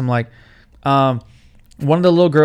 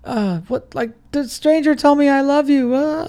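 put-on little girl voice; 11 semitones higher than usual. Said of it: "and she's like".

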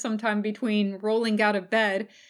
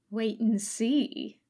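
mysterious; slower than usual. A narrator's reading. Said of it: "Nanami said mysteriously".

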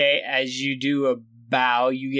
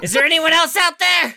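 calling out; 12 semitones higher, 6 dB louder than usual. Acted. Calling out loud.

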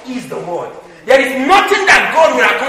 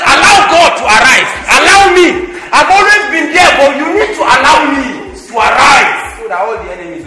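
loud, high preacher's proclamation; God's urgent cry; 4 semitones higher, 6 dB louder than usual. Acted. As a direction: cry it out as God pleading to be allowed.

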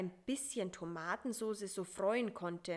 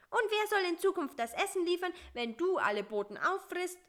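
high, quick character voice; Bissi challenging; 10 semitones higher, 4 dB louder, faster than usual. A narrator's reading. Bissi questions the spider about future food deliveries, high and challenging. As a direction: challenge him in a high, hurried voice.

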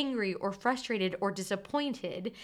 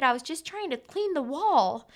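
put-on child's voice; 6 semitones higher, 6 dB louder than usual. Voicing her three-year-old's excuse.

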